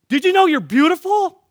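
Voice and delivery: high, loud call to a passer-by; excited retold yelling. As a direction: call it out joyfully to everyone you see. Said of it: "I'm yelling".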